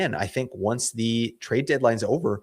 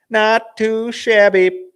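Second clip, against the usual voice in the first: playful drawl; 12 semitones higher, 9 dB louder, much slower than usual. Pretend. Pleased over player stats.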